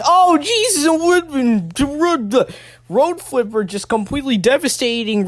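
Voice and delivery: high, startled commentator's cry; shocked outburst. Real, not acted. Commentator reacting live to a road flipper wreck, shocked. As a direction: burst out high, then keep calling the wreck.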